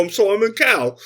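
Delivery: high, loud mock-Cockney accent; wrongly Cockney, loud and broad; Cockney Simon Cowell. Shown as a demonstration. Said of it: "He's not Cockney".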